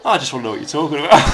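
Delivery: loud, fast plea, laughing through it; mock dad pleading over the radio; retold dad's plea. Telling a friend how dad pleaded on the CB, loud and fast. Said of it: "And he went".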